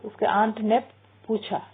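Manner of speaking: usual speaking voice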